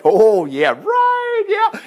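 high, sarcastic "oh yeah, right"; sarcastic disbelief. Pretend. Preacher mocking doubters of God's promise, sarcastic.

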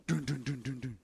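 low, soft suspense sting; dramatic dun dun. Acted. Door knock in the story, low soft suspense cue. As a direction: hum it like an ominous movie cue.

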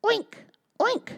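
high squealy oinks; pig oink. Acted. Missus Pig greets baby mouse, high oinking. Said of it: "said Missus Pig".

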